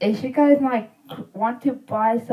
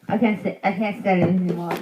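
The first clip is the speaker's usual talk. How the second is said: ordinary delivery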